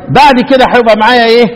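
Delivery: normal talking voice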